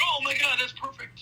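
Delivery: high-pitched mock amazement; delighted reaction. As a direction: act out a thrilled discovery, voice jumping up. Retelling his delighted reaction.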